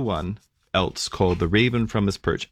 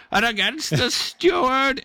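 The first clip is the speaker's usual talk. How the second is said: indignant raven. Acted. Sulking raven answers the angel with wounded pride. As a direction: squawk out the injured pride of a neglected raven. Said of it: "the raven turned a yellow eye".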